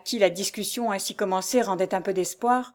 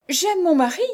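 high, loud declaration; desperate protest; 9 semitones higher, 5 dB louder than usual. A narrator's reading. Jeanne pleads love for her husband, desperate. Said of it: "avec l'énergie du désespoir".

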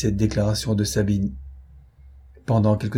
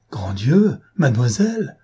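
a startled cry, a bit louder and higher; 3 semitones higher, 4 dB louder than usual. A narrator's reading. Onésime exclaims to the young lady in alarm. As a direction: gasp out in dismay. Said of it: "s'écria Onésime".

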